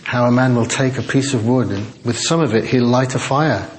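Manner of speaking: normal talking voice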